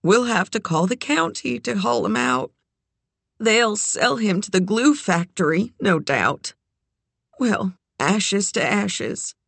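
Doc's resigned, lightly muffled lament; muffled words while exhaling. A narrator's reading. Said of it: "her words muffled".

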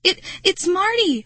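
high, anxious stammer. A narrator's reading. Girl telling her dad about Marty, stammering. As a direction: stumble on the first word in distress. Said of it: "I stammered".

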